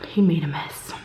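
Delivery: breathy "He made a mess" in a whisper; hushed, voiceless "He made a mess"; whispered aside. Retold or imitated. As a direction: whisper it like gossip. Hushed aside about the guest.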